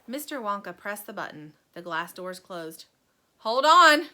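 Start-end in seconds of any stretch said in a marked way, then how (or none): 3.4-4.1 sudden high shout; loud cry. A narrator's reading. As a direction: yell a warning. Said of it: "cried Mister Wonka".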